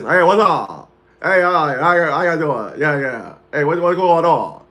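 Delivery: put-on slurred, mumbled old-uncle greeting; Uncle Al impression. Nephew mimics how his uncle talks on the phone, slurred and mumbled. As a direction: run the words together like a mumbling uncle. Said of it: "my uncle talked like this".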